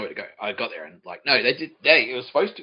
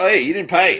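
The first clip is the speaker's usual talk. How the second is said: loud, raised-pitch call to stop someone; calling out in challenge; 8 semitones higher, 11 dB louder than usual. Retold or imitated. Restaurant staff stop him as he goes to leave, calling out loudly. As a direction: call them back, high and loud.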